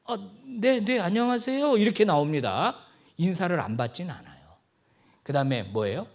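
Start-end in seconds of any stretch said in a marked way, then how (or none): 0.1-1.8 high-pitched, caught off guard; acted flustered hello back to a stranger; startled greeting. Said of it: "깜짝 놀라면서".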